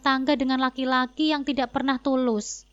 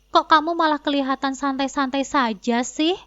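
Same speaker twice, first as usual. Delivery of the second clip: usual speaking voice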